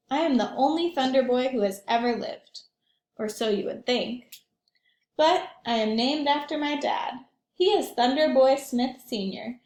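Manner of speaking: lively picture book read aloud; gently animated storytime voice; reading to children. A narrator's reading.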